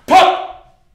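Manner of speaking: loud, high imitated gun pop; loud mouth-made gun sound; mock gunshot pop. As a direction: spit out a gunshot noise. Imagined gunshots.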